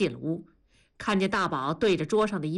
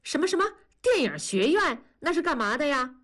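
high-pitched, urgent questioning; alarmed calling out; 6 semitones higher than usual. A narrator's reading. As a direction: burst in demanding an explanation. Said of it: "文丽冲进来就喊". (冲进来高声喊问)

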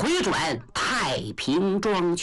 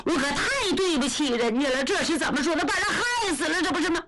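high, wailing lament of guilt; a lady bursting into tears over the note; crying out; 7 semitones higher, faster than usual. A narrator's reading. Lady reads suicide note and wails in guilt. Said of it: "一声就哭了".

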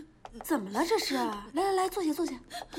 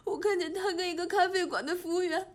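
tearful, sobbing complaint; crying. Acted. Girl tells her aunt what she saw, crying. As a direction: tell it through sobs. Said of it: "别哭了".

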